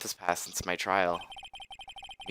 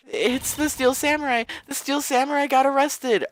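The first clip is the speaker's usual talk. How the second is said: much higher, louder frantic news; high frantic girl; 5 dB louder than usual. Acted. Maya tells Nick the Samurai was arrested, high panic.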